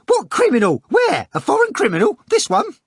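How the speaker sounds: very high, loud mock-eager bawl. A narrator's reading. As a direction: bawl it out in clownish excitement.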